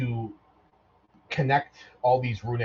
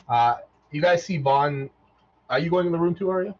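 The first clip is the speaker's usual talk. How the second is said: ordinary talk, nothing marked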